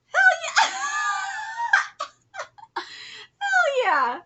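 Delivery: high whooping shout with laughter; exultant cheer. Real, not acted. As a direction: whoop it out very loud. Cheering at the manga.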